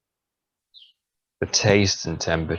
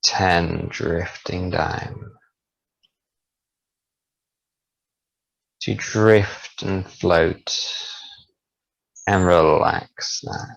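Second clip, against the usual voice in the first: slow soothing countdown; slower than usual. Acted. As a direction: count them down into sleep. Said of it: "as I slowly count backwards from ten to one".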